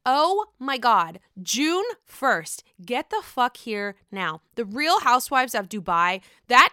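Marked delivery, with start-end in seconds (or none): none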